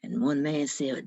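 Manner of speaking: ordinary delivery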